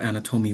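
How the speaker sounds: "anatomy" said "ana-TOH-mee", third syllable stressed; misplaced word stress. Retold or imitated. Teacher puzzled by student's unknown word, stress on the third syllable. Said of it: "she wasn't trying to say anatomy".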